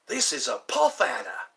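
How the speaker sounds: lightly imitating the excited wildlife host; Crocodile Hunter impression. Recalling the Crocodile Hunter show, light Australian presenter imitation. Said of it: "Crocodile Hunter".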